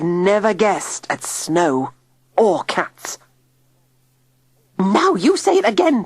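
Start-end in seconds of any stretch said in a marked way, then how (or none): 4.8-6.0 high, loud bossy command; ordering. A narrator's reading. Tilly ordering Digby to repeat himself. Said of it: "Tilly ordered".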